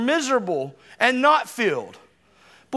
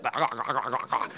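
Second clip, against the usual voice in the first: rapid low throat glugs; soft, deep, repeated gulping noise; imitated gulping; 11 semitones lower, 8 dB quieter than usual. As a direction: mimic guzzling water from a pitcher, quietly. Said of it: "just be like".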